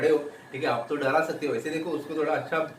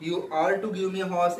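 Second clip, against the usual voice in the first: slow read-aloud; much slower than usual. Acted. Girl's demand repeated.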